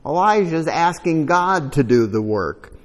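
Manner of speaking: ordinary talk, nothing marked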